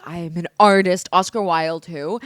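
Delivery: loud, self-important proclamation; mock grandiose. Fourth-grade playwright proud of her script, mock grandiose. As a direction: declare it with playful grandeur.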